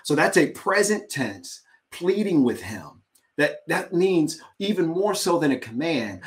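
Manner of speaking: ordinary talk, nothing marked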